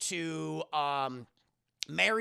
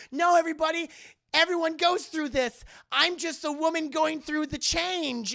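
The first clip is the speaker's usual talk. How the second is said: high, loud hammed-up protest; pleading excuse; 10 semitones higher, 8 dB louder than usual. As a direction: desperately explain it away to everyone. Exposed woman pleads her excuse.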